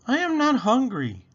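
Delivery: put-on child refusing. Penny refuses dinner.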